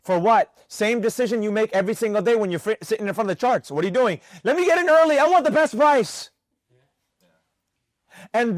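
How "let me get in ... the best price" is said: higher-pitched, impatient outburst; eager trader. Acted. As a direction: mimic an overeager trader, pitched up. Voices an impatient trader's impulse.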